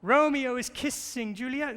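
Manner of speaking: high, loud imitated child's voice, thrilled; excited little girl. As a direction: play the daughter bursting with news. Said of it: "And she said".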